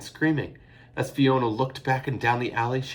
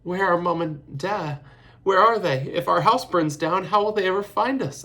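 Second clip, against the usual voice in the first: sobbing; 10 semitones higher, 8 dB louder than usual. A narrator's reading. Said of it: "Eilish sobbed".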